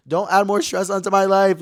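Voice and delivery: high, loud complaint; loud, high exasperated outburst; exasperated plea. Acted. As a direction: burst out at an absent neighbor, fed up. Exasperated plea to absent neighbor.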